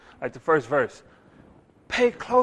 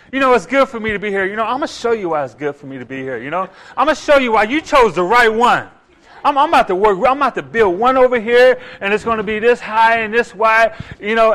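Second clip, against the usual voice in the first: fast, louder self-important bragging; mock boasting Peter; 4 semitones higher, 7 dB louder than usual. Preacher mocking Peter showing off for God. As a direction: rattle off eager self-praise.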